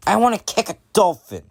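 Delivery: put-on high, quick child's voice; high-pitched kid demand; bratty little kid. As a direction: play a demanding six-year-old. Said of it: "And the kid's like".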